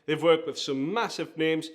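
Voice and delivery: ordinary talk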